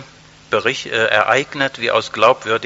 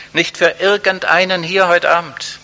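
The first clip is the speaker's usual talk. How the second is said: ordinary talk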